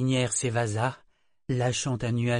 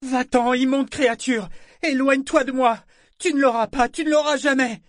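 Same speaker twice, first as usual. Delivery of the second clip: much higher and louder, desperate shrieking; frantic terror; 8 dB louder than usual. A narrator's reading. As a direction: drive the monster away in panic. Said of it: "Éperdue".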